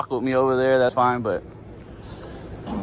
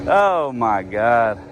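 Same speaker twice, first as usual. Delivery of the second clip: slow, drawn-out low groan of words; exasperated; 6 semitones lower, much slower than usual. Real, not acted. Detained man reacting to officer's reason, exasperated. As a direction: stretch it out in weary disbelief.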